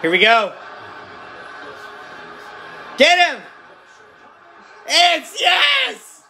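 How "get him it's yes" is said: high shouted cheers for the team; cheering at a game. Real, not acted. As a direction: scream for the defense to finish. Fan cheering final defensive stand.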